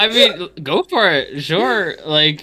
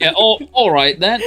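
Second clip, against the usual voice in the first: higher, louder mimicked British accent; mock British accent; 8 semitones higher, 4 dB louder than usual. Co-streamer echoing the bad English bit, mock British accent. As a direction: echo it in a put-on British accent. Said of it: "he's, he's British".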